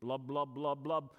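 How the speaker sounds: low, popping bubble sounds; bubbling blubs. Pretend. Preacher mocking the primordial soup, low bubbling blubs.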